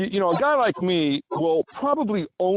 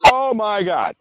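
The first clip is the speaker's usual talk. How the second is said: high, loud flabbergasted exclamation; amazed Oh my God, pitched up and louder; shocked gasp; 9 semitones higher, 6 dB louder, slower than usual. Shown as a demonstration. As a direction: show pure shock on Oh my God. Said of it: "Flabbergasted means just shocked, amazed".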